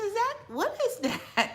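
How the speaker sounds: high, slow, half-laughing what-is-that; puzzled retold question. Retelling to viewers what she asked coworker, high puzzled laugh. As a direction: ask it like you can't believe it, giggling. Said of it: "I said".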